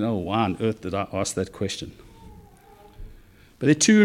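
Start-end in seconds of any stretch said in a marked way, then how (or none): 0.0-1.9 quiet, rueful inner groan of the lawyer; regretful lawyer. Retold or imitated. Lawyer regrets his question, quiet rueful muttering. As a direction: mutter it as the embarrassed lawyer to himself.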